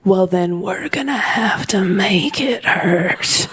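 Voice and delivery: low, slow creepy whisper; menacing whisper. Retold or imitated. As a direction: whisper the threat slowly. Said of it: "in a voice I'd never heard".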